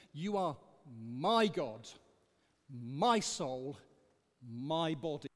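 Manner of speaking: my leaned on in "You are my God, my soul, my body", slow and deliberate; slow down and lean on each "my"; stress on my. Shown as a demonstration. Preacher pointing out the repeated word, stressing each my. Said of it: "why does he say "my" three times?".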